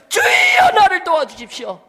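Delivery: loud, high pleading shout, voice breaking; 목이 메도록 외치는 기도; desperate crying prayer. Shown as a demonstration. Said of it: "이렇게 기도한다는 거예요".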